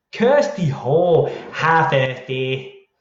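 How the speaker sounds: drawn-out cartoon kid voice; in-joke cartoon voice, slow; Cartman impression. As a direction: stretch out the name in a rough cartoon impression. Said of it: "I used to give my Cartman voice".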